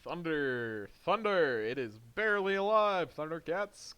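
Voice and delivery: rising cartoon rallying cry; playful theme-song style shout; mock battle cry. Mock battle cry after lightning punch.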